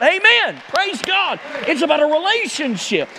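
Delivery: high, ringing praise; ecstatic amen. Acted. Pastor exulting before the church, ringing praise. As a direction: lift it to the rafters. Climax of the sermon.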